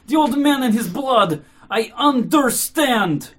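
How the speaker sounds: high, loud, drawn-out outburst; frenzied cry. Acted. Mitya answers the officials, crying out in a frenzy. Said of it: "Mitya cried in a frenzy".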